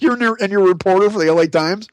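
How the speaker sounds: incredulous. Retold or imitated. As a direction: needle her. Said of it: "And I'm like".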